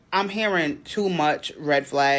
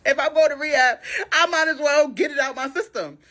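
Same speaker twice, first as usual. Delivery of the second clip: much higher, louder sarcastic mimicry; mocking his logic; 11 semitones higher, 4 dB louder than usual. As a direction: parrot his excuse with scorn.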